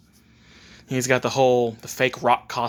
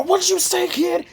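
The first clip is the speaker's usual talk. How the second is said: high, raised, incensed question; angry challenge; 4 dB louder, slower than usual. Acted. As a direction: demand it, temper flaring. Naruto angered by the brat.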